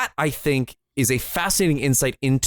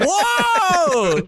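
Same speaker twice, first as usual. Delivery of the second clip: surprised whoa; 4 dB louder than usual. Real, not acted. Reacting to riddle rhyme.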